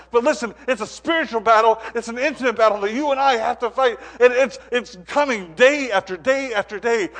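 preaching. Acted. Pastor warning Christians about Satan's attacks, preaching hard and loud. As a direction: pound it home to the congregation.

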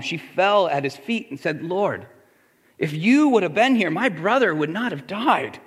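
calm, measured Bible reading; scripture reading. Acted. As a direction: read the passage steadily to the congregation. Reads scripture passage aloud.

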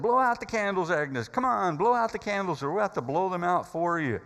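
loud, high, coaxing call; urging. Retold or imitated. Harry urges Agnes to blow out candles, loud coaxing. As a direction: cheer her on to blow.

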